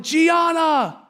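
put-on high-pitched call to a child on the field; sideline parent yell.

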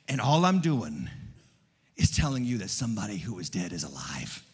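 low, measured delivery with long pauses; solemn preaching. Real, not acted. Preacher tells Easter congregation that the dead one is alive, solemnly. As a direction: let it settle low and soft, phrase by phrase.

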